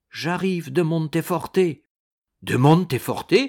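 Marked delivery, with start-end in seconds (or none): 2.4-3.4 loud, astonished echo of the name; sudden loud exclamation on hearing the place; startled exclamation. A narrator's reading. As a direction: cry it back in surprise. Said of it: "s'écria Ragastins".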